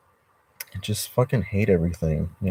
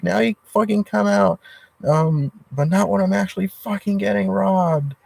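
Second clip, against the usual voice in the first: mock rage yell at the landlord; retold furious yell; 13 semitones higher than usual. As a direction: relive the blow-up, pitch flying up. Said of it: "yelling at the top of my lungs".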